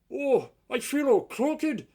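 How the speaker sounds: high, loud complaining whine. Acted. Broken engine complaining to driver, high whine. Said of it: "he complained".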